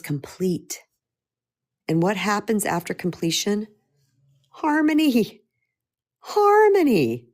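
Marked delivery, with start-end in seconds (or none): 4.5-5.4 higher and bright, a bit louder; uplifted word. Real, not acted. Speaker answering her own question, lifted. First take, plain-ish. 6.2-7.3 sung-out high, slow and louder; soaring harmony. Real, not acted. Speaker celebrating the answer to her own question, sung out high. Repeated, higher and drawn out.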